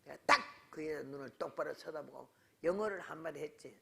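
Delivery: usual speaking voice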